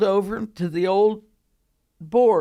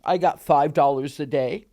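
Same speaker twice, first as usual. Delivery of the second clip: ordinary delivery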